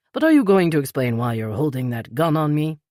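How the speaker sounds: lightly voiced older British man; a gentle hint of the polite Peter; older British gentleman, lightly voiced; Peter's voice. A narrator's reading.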